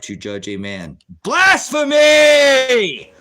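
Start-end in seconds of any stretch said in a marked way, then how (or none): 1.2-3.1 very high, drawn-out shout; full-force screamed denunciation, every syllable stretched; thundering accusation. Real, not acted. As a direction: bellow it long and high at the offender. Accusing a chatter of blasphemy.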